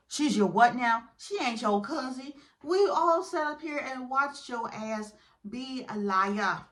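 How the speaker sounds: hammed-up indignant scolding; sassy call-out. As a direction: call out his lie, punching the words. Confronting the cheater's lie.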